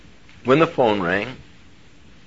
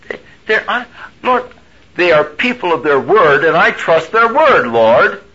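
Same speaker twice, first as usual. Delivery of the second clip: higher, louder earnest protest; insistent plea; 9 semitones higher, 7 dB louder than usual. Retold or imitated. Preacher retells arguing with God, insistent. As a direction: press the point hard.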